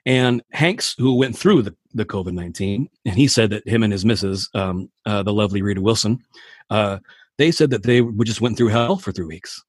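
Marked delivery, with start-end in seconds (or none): none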